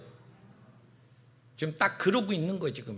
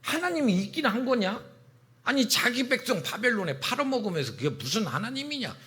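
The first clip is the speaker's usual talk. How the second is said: high, scoffing jeer; mocking; 5 semitones higher than usual. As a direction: sneer like unbelieving neighbours. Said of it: "이러고 조롱하더라는 거예요". (비아냥대며 조롱하는 말투)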